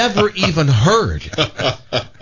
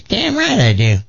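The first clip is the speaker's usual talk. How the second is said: feisty granny; 7 semitones higher than usual. Acted. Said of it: "She says".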